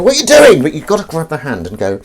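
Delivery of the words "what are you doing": high, loud outraged cry; much higher and louder, alarmed; startled protest. Acted. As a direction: act someone shocked at being grabbed. Acting a shocked stranger whose hand is grabbed.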